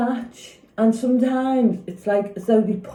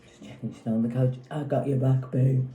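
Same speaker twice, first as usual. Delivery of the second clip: low, quick, soft mock of a sweet husband; lightly voiced doting husband, hushed and hurried; imitating the quiet husband; 9 semitones lower, 6 dB quieter than usual. Mocking the husband the wife wants, low and fast, hushed. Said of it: "speaks up and goes".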